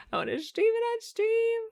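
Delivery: high silly voice. Pretend. Streamer joking to chat, squeaky high voice. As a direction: pitch it way up like a giddy kid.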